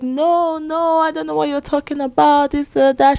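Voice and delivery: retelling a suspect's flustered denial, mock high voice; frantic denial. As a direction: imitate her protesting, voice jumped high. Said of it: "She's denying".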